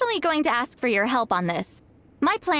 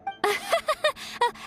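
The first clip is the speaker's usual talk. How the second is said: nervous laugh; 3 dB quieter than usual. Acted. Caught in the act, awkward.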